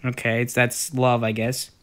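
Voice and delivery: normal talking voice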